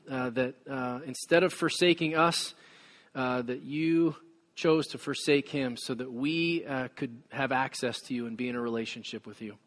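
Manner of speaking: soft, hushed pastoral prayer; low, quiet prayer voice. Acted. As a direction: pray quietly to God before the sermon. Opening prayer.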